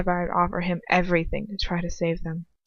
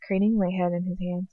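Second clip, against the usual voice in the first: usual speaking voice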